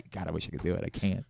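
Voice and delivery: ordinary delivery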